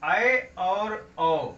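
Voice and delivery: ऐ and औ held very slowly; drawn-out vowels. Shown as a demonstration. Teacher sounding vowels for the class, very slow. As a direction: stretch ऐ और औ to show their length. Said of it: "द्विमात्रिक हैं".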